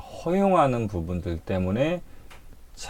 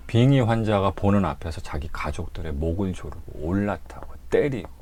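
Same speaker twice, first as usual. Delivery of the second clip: normal talking voice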